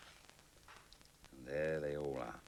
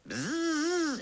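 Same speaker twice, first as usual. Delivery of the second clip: very high, louder buzzing; bee buzz; 8 dB louder than usual. Acted. Bee collecting pollen, a high buzzing. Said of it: "thought the bee".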